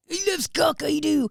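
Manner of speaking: high, loud Bristol accent impression; hammed-up Bristolian, pitched right up and belted; broad West Country accent showpiece. Shown as a demonstration. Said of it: "Do the accent".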